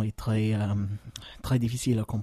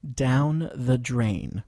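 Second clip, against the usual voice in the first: very slow, careful teacher model; slow model phrase; much slower than usual. Shown as a demonstration. Teacher models the phrase slowly for learners. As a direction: stretch it out for students to repeat. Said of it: "écoutez et répétez".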